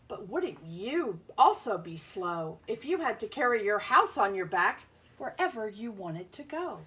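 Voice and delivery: ordinary talk, nothing marked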